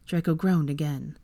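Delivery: usual speaking voice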